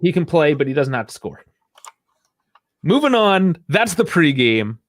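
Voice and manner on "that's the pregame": normal talking voice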